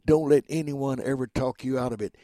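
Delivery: ordinary delivery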